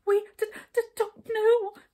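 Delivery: high, loud stammering; dismayed stammer. A narrator's reading. Brownies answering the furious king, frightened stammer. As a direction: stutter it out in fright. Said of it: "stammered the brownies in dismay".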